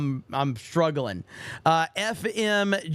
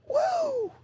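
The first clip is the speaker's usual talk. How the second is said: strained, much higher hoarse Ric Flair woo. Retold or imitated. Streamer attempts a Ric Flair woo, hoarse and cracking. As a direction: squeeze out a cracking wrestler's woo. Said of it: "That's how hoarse I've gotten".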